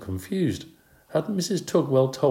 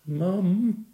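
moaning; 11 semitones higher than usual. A narrator's reading. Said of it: "Garth moaned".

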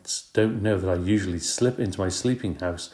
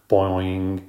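twanging boing. Acted. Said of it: "he twanged".

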